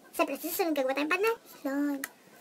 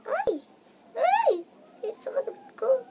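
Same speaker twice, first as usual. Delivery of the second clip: sudden high yelps of surprise; high startled cries; 11 semitones higher, 3 dB louder, slower than usual. Real, not acted. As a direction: jump at a shock.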